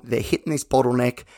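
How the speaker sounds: ordinary talk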